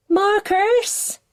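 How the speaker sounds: high, rising call up the stairs; a mum calling her son; high distant call. A narrator's reading.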